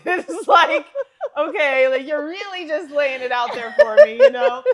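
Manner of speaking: cracking up at how obvious it is; laughing disbelief. Real, not acted. Laughing at the movie's bluntness.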